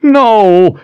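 high, loud anguished wail. Acted. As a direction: cry out in despair. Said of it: "Kaelin wailed".

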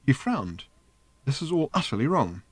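plain reading voice

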